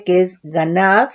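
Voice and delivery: ganav said slowly, stress on the second A, Hebrew sounds; Hebrew word. Shown as a demonstration. Teacher pronounces ganav slowly for the congregation, Hebrew stress on second A. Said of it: "con acento en la u-, segunda A".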